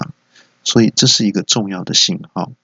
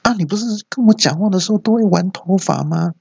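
much higher, a whiny complaint; petty indignant question; 11 semitones higher than usual. Acted. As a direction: sulk and demand an explanation. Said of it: "就更小灯小气了". (被拒后小气地质问女同事)

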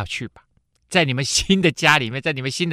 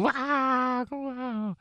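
high, hammed-up wail that fades away; playful mock sobbing wail; mock wailing cry; 13 semitones higher, 4 dB quieter than usual. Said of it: "听完了又放声而哭".

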